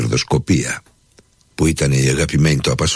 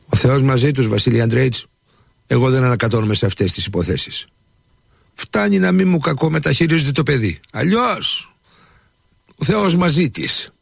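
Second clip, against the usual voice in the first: much higher, resigned servant voice; humble servant; 10 semitones higher than usual. A narrator's reading. Nikita answers his master. (ψηλή, παραιτημένη φωνή υπηρέτη)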